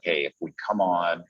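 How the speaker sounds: ordinary delivery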